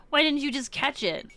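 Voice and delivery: ordinary talk, nothing marked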